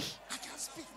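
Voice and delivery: slow whispered phone excuse; whispering on the phone. Retold or imitated. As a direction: whisper so nobody hears. Son hushed on phone in library.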